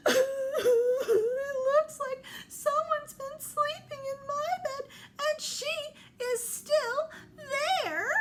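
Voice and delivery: high, loud tearful cub voice, slow; crying baby bear. Acted. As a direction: wail out the discovery like a little cub. Baby Bear finds Goldilocks.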